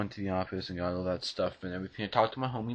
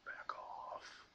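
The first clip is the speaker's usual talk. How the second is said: breathy warning whisper; whispered back off; 18 dB quieter than usual. Shown as a demonstration. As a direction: hiss it softly as a warning. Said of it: "the variations of how I react".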